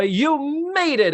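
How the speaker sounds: high, drawn-out triumphant cry; joyful shout. Retold or imitated. Retelling Phyllis finally content in the chair, joyful shout. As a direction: stretch it out high in mock jubilation. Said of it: "she shouts for joy".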